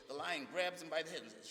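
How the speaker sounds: ordinary delivery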